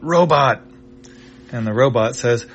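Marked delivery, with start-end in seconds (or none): 0.0-0.6 a sharp loud call, pitched up; yelling. Acted. Chippu yells for the robot, loud and urgent. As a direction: bark the name across the room. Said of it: "he yells at the robot".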